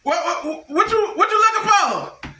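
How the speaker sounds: high, heated yelling at the stranger; telling off a man at the door; going off. Retold or imitated. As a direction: snap at him, pitch way up. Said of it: "I'm just going off".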